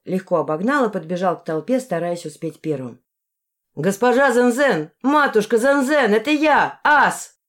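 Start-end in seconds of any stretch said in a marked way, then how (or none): 3.8-7.4 loud, higher-pitched call to the villagers; shouting. A narrator's reading. Ас hails matushka at the edge of the village crowd, shouting. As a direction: call out loudly and high to be recognised. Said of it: "крикнул он на цзинь".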